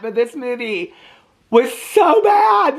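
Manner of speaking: stretched-out shouted so bad; exasperated outburst. Real, not acted. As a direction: belt out how bad it was. Ranting about a movie she hated.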